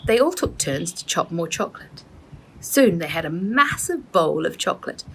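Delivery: plain reading voice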